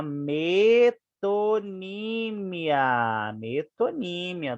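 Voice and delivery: "metonímia" stretched slowly, syllable by syllable; drawn-out syllables. Shown as a demonstration. Teacher correcting the answer to metonymy, drawn-out syllables. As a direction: draw out every syllable of "metonímia".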